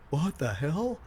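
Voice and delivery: shocked crowd. Retold or imitated. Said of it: "still gasping, going".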